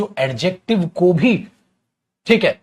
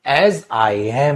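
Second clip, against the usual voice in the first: slow dictation; 4 dB louder, much slower than usual. Shown as a demonstration. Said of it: "मैंने पूरा sentence लिख दिया".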